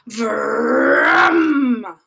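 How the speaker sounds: loud, long rolled vroom; drawn-out mechanical roar; imitated roaring engine. A narrator's reading. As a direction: rev it with a rolling r. Imitates big sound in woods.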